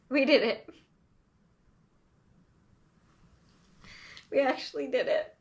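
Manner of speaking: choked-up, teary words between long pauses; fighting back tears while getting the words out; emotional happy tears, voice catching; real crying. Real, not acted. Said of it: "I'm crying".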